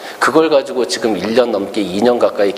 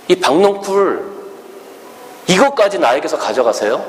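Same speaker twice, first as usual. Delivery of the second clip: irritated complaint; 5 semitones higher than usual. Acted. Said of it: "막 화를 내고 짜증을 내죠".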